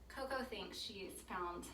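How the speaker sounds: ordinary talk, nothing marked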